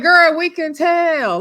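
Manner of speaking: high, loud, drawn-out sass; exasperated girlfriend clapback; sassy exclamation. Acted. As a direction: call her out, stretching it high and loud. Sassy imagined reply to Jody.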